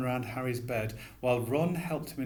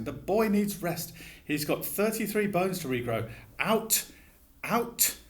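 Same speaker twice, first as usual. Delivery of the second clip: higher, rising scolding shout; shouting matron; 6 semitones higher than usual. A narrator's reading. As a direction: shoo the visitors out, mock yelling.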